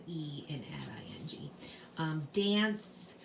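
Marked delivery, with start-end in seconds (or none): 0.1-1.5 softly whispered aside; whispering. Real, not acted. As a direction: whisper it to yourself. Aside while writing.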